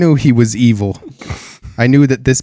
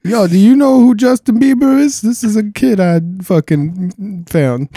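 [name] impression; 10 semitones higher than usual. Voicing [name] behind the door.

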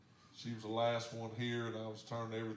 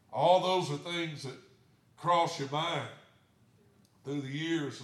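higher, louder preaching voice; 4 semitones higher, 8 dB louder than usual. Acted. Pastor preaching to the congregation about years of worry, raised. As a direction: lift it up as a sermon point.

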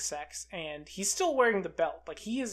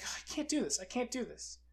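high, soft, mildly panicky protest; mock nervous; 5 semitones higher, 8 dB quieter than usual. Gawain flinches before the axe, mock nervous. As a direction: imitate a nervous man backing out, higher and softer. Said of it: "he gets nervous again".

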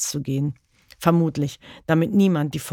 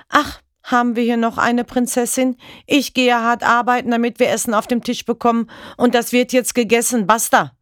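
higher, louder mocking rage; furious sneer; 7 semitones higher, 4 dB louder than usual. Father yelling at his crying daughter over dinner, furious. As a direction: sneer then slam it down.

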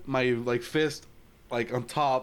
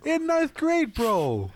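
mock rant at teacher; 11 semitones higher than usual. A student ranting at the teacher who scolded him for yawning, in a high mock whine. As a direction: squeal it like a fed-up teen. Retelling yawn complaint to teacher.

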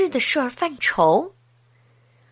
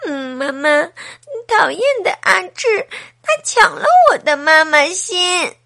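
mock whimpering complaint, higher and louder; a lightly acted child tattling through tears; crying to mom; 5 semitones higher, 4 dB louder than usual. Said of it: "一边走一边哭". (小女孩边哭边向妈妈告状)

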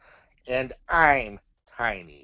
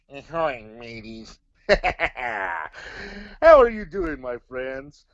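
pirate voice; 4 dB louder, slower than usual. Acted. Pirate puppet greeting.

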